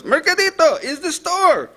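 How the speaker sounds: put-on very high accented cry; shrieky-pitched, desperate caller imitation; imitated panicked caller. Caller insists over bedlam in the background, imitated panic.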